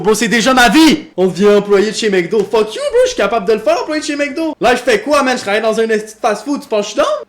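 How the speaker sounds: loud, high indignant ranting; mock outraged rant. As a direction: yell like you're insulted, half joking. Mock rant against ChatGPT suggestion.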